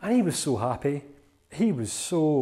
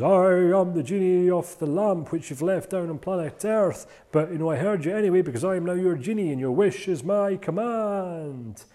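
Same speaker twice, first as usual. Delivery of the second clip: grand genie, pitched a bit higher and louder; 4 semitones higher, 5 dB louder than usual. Acted. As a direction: announce it like a magical genie. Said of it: "out pops this big purple genie".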